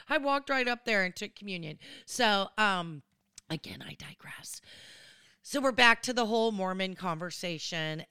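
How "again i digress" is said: soft whispered aside. Real, not acted. Whispering an aside to viewers about digressing. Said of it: "unvoiced whisper".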